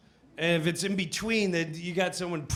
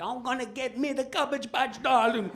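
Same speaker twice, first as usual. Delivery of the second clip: put-on Jamaican accent; 8 semitones higher than usual. Voicing Jamaican shopper.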